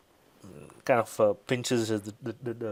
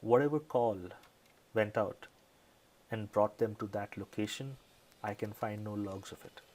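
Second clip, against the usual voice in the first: lightly voiced lieutenant, subdued and halting; understated, secretive police boss; guarded lieutenant, confiding; 7 dB quieter than usual. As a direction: confide quietly, with long pauses.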